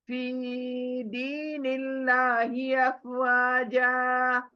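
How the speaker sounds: slow, drawn-out verse recitation; Quran recitation. Acted. Teacher recites the verse slowly for the student. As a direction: chant each word slowly and evenly.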